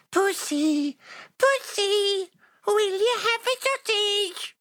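very high tiny elf calling; high squeaky elf voice. Acted. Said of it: "and she said".

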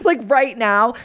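high, exasperated plea; mock pleading, high. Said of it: "but I'm like".

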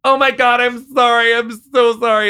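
high, loud reenacted wailing apology; frantic apology. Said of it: "and I was like".

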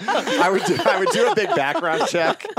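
laughing through the words. Real, not acted. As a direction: talk while cracking up. Joking about a creepy plan.

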